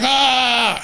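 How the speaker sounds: high mock shriek of a teacher; hammed-up panicked teacher scream. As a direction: yelp it high like she snapped. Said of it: "she was like".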